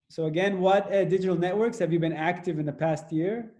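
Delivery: ordinary delivery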